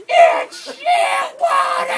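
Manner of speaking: sudden high yell, drawn out slowly; shocked yell. Retold or imitated. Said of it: "somebody was like".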